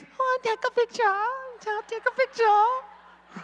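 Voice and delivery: high-pitched mock Chinese ladies; imitated Chinese ladies. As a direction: squeal it high like excited lady fans. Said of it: "And then the ladies".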